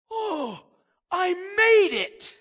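high, slow, loud cry of relief; relieved surprise. Retold or imitated. Newcomer arriving in heaven, high relieved cry. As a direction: gasp out that you barely made it. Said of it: "and he goes".

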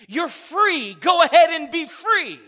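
loud, high preacher's call; rousing shout. Real, not acted. Pastor urging congregation to be free, shouting. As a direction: lift the whole room with it.

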